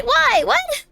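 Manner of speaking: sudden squeaky alarm; startled. Real, not acted. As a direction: jump at the surprise. Sudden shock in game.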